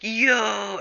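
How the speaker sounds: high, drawn-out hail; mock delighted greeting. B T and Freddy notice each other, mock delighted. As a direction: sing out in happy recognition. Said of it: "and they're like".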